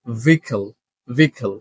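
vehicle said as two syllables, VEE-kul, silent h; correct pronunciation. Shown as a demonstration. Teacher models the first word for learners, correct pronunciation. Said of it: "সঠিক উচ্চারণটা হচ্ছে".

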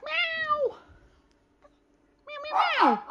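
high, mock cat meow; imitated meow. Meowing at the cat.

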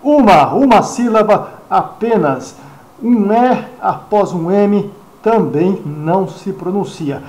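ordinary delivery

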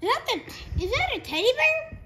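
surprised. Real, not acted. Surprised at teddy bear.

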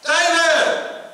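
high, loud call across the room; hollering a name. Retold or imitated. A guy across Burger King hollering for his friend, loud call. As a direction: shout the name to get his attention. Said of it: "there's another guy hollering".

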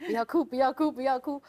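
high, loud mock pep talk; retold plea to oneself, pushed up high; urgent self-coaching. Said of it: "一直告诉自己".

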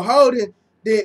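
normal talking voice

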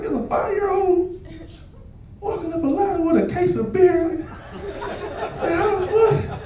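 high, slow stunned murmur; hushed, high-pitched mock astonishment; acted disbelief. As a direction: marvel slowly at what you're seeing. Retells his stunned reaction to child.

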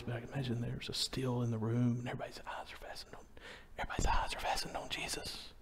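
fast, unvoiced whisper setting the hushed scene; storyteller whispering the crowd's stillness. Shown as a demonstration. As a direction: breathe it so the room goes still. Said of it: "if they hear me whisper".

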